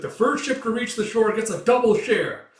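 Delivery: loud, high promise to the crew; pirate captain hollering; rallying shout. Acted. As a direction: fire up the rowers.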